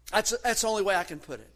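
usual speaking voice

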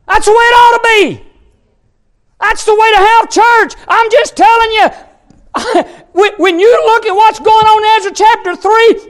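high, driving preacher's delivery; real pulpit excitement, high and insistent; fired-up preaching. Real, not acted. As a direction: preach it with rising fervor. Preaches at full pitch.

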